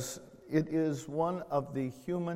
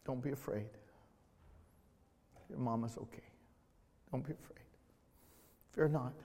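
soft, fading to a whisper; Jesus softly reassuring a fearful listener; gentle reassurance; 2 semitones lower, 10 dB quieter, faster than usual. Acted. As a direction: comfort a frightened child, almost whispered. Jesus' voice to little boy.

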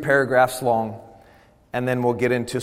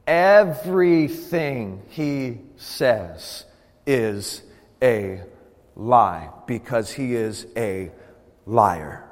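very slow "Everything he says is a lie", a pause after each word; word-by-word emphasis; 2 semitones lower, much slower than usual. Real, not acted. Preacher drives home that he is a liar, one slow word at a time.

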